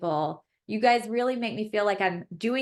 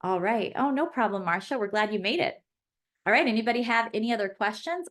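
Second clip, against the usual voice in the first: normal talking voice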